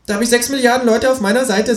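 high, fast retort; high-pitched defiant kangaroo; arguing kangaroo. Acted. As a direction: fire back quickly in a high voice. Kangaroo argues after losing game.

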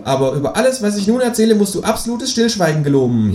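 viel höhere, freche Figurenstimme; Känguru-Stimme. Acted. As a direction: das Känguru hoch und keck sprechen. Känguru spricht. (hohe, kecke Känguru-Stimme)

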